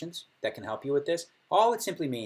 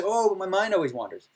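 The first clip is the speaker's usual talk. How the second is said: higher, whiny mock complaint; mock complaining student; 8 semitones higher, 6 dB louder than usual. Meditation advice, imitating a worried beginner, mock whine. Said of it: "people say".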